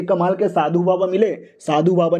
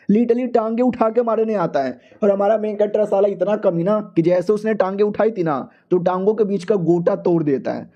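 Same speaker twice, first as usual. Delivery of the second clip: ordinary delivery, nothing marked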